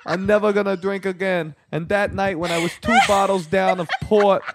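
high-pitched parody of a Jersey bro, slowing down at the end; mocking the caller. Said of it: "That guy was so full of it".

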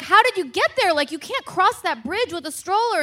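high-pitched retold panic; alarmed questioning. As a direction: replay a worried daughter's disbelief. Alarmed daughter confronts dad, retold.

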